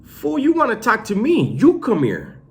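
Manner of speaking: loud, high-pitched challenge; mock irritated retort. Retelling his retort to a sneaky inmate, loud mock irritation. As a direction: call him over, exasperated and hammed up.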